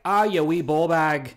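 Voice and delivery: loud mock-Scottish jeer; high, loud imitated Scottish heckler; Scottish accent. Imitating Scottish viewers of his stream, Scottish accent. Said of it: "people on my live stream who are from Scotland".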